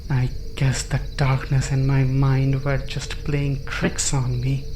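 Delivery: plain reading voice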